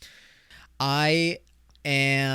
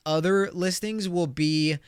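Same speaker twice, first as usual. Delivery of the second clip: usual speaking voice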